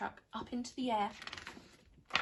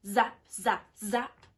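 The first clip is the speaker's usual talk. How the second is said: zaps; 11 dB louder, much slower than usual. Acted. Computer zaps.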